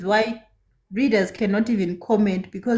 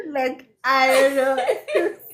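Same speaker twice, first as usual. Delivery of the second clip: kind gentle laugh; 2 semitones higher than usual. Real, not acted. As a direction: giggle as you trail off. Laughing about comments.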